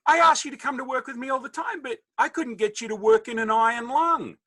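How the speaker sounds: high-pitched mock scolding; exasperated dad. Dad complaining his son never works, mock exasperation.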